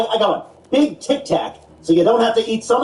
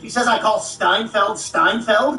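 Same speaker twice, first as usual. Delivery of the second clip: ordinary delivery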